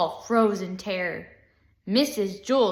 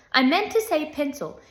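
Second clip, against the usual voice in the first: higher, irritated teacher voice; testy self-correction; snapped; 6 semitones higher than usual. A narrator's reading. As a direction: snap back in a raised pitch. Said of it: "snapped Missus Jules".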